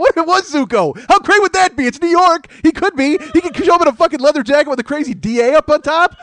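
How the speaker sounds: high, loud, laughing rant; hammed-up pleading. Host mock-begging the choosy friend, high loud laughing plea. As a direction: beg the friend like a jilted lover.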